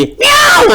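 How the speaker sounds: very high yowling meow; pouncing cat meow. A narrator's reading. Cat pounces on the mouse and her babies, yowling. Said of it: "అంటూ మీద పడింది".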